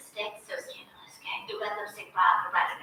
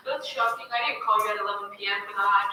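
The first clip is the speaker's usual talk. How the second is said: fake casual [name]. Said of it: "he's trying to be all natural with it".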